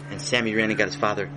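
ordinary delivery, nothing marked

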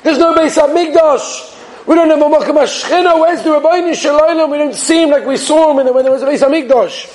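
high, pleading retold lament; fervent, high-pitched appeal; impassioned emotion. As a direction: cry out in longing, acting the rabbi. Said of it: "said it with such emotion".

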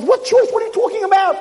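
very high bewildered outburst; high-pitched frantic question; panicked protest. Retold or imitated. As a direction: demand to know, in a panic. Chaim baffled, protests.

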